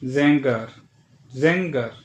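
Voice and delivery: ज़ेंगर with a nasal ङ and no hard g; German ng sound. Shown as a demonstration. Teacher showing the German ng in Sänger. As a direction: glide ज़ेंगर through a nasal ङ. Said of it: "अंक की तरह sound करता है".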